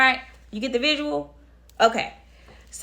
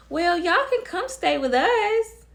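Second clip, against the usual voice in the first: high, drawn-out mocking imitation; put-on high voice, stretched out; mimicked mother's offer; 9 semitones higher, slower than usual.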